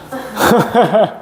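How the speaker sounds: short run of chuckles; real laugh breaking into the lecture; amused laugh. Real, not acted. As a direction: chuckle lightly mid-lesson. Laughing after a yawn remark.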